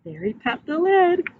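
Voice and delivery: usual speaking voice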